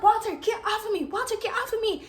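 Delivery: high, insistent mock whine; acted squirming complaint, high-pitched; retold protest. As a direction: demand it in a pushed-up voice. Pinned, telling boyfriend off.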